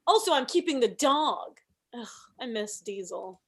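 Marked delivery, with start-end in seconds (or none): 0.1-1.6 imitating ex. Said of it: "he said".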